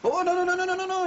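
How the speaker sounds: a boss's sudden high-pitched veto; alarmed no. Real, not acted. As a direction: stop him dead, high and urgent. Boss stops Malcolm lunching consul.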